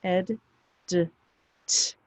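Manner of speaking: "ed", "d", "t" sounded separately; phonics sounds. Shown as a demonstration. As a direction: sound out "ed", then short "d", then voiceless "t", pausing between. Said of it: "The past tense ending".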